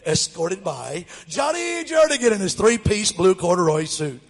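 loud stadium announcer call over the crowd; booming announcer. Retold or imitated. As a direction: boom the names like a PA announcer. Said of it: "they said my name louder than anybody else's".

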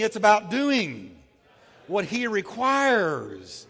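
slow, emphatic words for the crowd to repeat; slow call and response. Shown as a demonstration. Preacher leading the congregation in repeating a line, slow. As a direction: draw it out for them to echo. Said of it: "So say with me".

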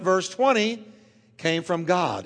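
ordinary talk

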